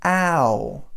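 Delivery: "ow" glided from ah to oo; American ow demo. Shown as a demonstration. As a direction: model the American "ow" clearly. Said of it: "we say".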